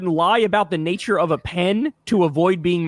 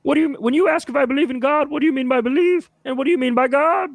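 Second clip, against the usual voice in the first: mocking Peterson; 7 semitones higher than usual. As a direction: parody his grand question. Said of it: "he could get away with saying".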